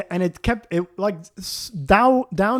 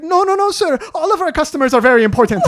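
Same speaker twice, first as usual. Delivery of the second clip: high, loud, fast mock manager voice; placating manager; 11 semitones higher, 8 dB louder, faster than usual. Relationship manager separating them in a high hurried voice. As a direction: rush in to calm him, high and bright.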